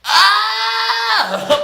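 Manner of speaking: high exasperated shout; sudden high frustrated cry; exasperated yelp. Retold or imitated. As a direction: let out a fed-up yelp. Exasperated reaction to boyfriend.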